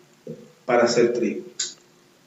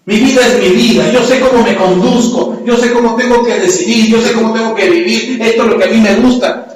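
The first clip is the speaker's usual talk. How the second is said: louder, higher defiant bragging; defiant boast; 6 semitones higher, 4 dB louder than usual. Acted. Proud believer defying the Holy Spirit, loud and stubborn. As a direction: stubbornly insist on doing it your way.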